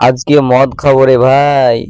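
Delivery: ordinary delivery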